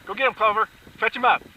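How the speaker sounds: building from calling to loud, high urging; urging dogs on. Real, not acted.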